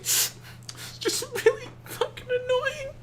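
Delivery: high, slow, mock-sobbing whine; hammed-up blubbering complaint; mock crying. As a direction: imitate a grown man breaking into tears. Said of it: "and he starts to cry".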